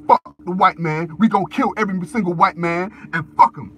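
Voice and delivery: a loud imitated furious outburst; angry mock rant. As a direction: bellow it like an enraged militant. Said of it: "we gonna say".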